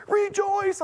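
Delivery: high-pitched joyful cry; retold exultation. He grabbed her by the shoulders, high exultant urging. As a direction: urge it high, in mock elation. Urges the upset woman, retold.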